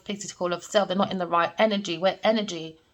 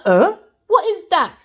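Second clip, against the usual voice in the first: surprised; 9 semitones higher, 7 dB louder than usual. Retold or imitated. Shocked at being pushed.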